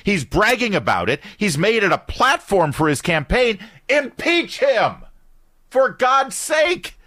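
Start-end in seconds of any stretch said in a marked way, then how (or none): 3.9-7.0 high, drawn-out cry of outrage; real indignant yell; outraged shout. Real, not acted. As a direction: shout it slowly, pitched way up in exasperation. Host rants demanding impeachment.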